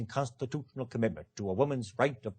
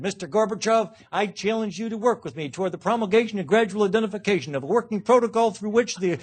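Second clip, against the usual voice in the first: higher, louder pompous droning oratory; lampooning Kerry's long-winded version of the speech; mock John Kerry; 7 semitones higher, 7 dB louder than usual. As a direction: parody a windbag senator. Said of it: "if John Kerry had been there, he would have said".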